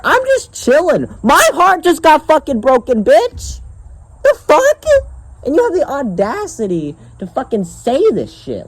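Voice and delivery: high, squeaky exasperated rant; outraged protest. Real, not acted. Vlogger protesting he broke no heart, outraged. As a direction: protest innocence in a high incredulous voice.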